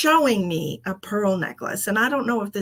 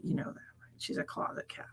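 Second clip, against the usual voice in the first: whisper; 14 dB quieter than usual. Real, not acted. Aside to chat about cat.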